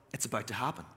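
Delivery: low, hushed aside to himself; quietly, as it dawns on you; hushed realization. Retold or imitated. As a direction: drop to a quiet, private certainty. Dawning realization before big moment.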